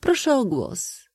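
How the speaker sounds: high, slow call for the floor. A narrator's reading. Princess asking the company for her turn, calling out. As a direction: pitch it up and draw it out. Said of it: "zawołała księżna Idalia".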